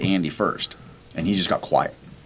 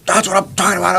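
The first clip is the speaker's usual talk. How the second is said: fired-up yell; 13 semitones higher, 5 dB louder than usual. Retold or imitated. Teammate finally clears obstacle.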